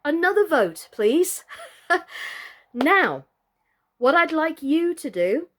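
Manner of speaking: high, loud and eager with a laugh; rally the viewers, bubbling over; excited appeal. Real, not acted. Host eagerly asks the kids for another vote.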